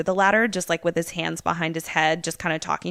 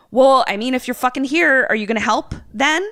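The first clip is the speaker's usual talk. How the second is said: louder, higher angry outburst; a dad snapping at his kid, raised voice; pissed; 7 semitones higher, 6 dB louder than usual. Retold or imitated. Angry dad scolds the runaway kid, louder and higher. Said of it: "like, super pissed".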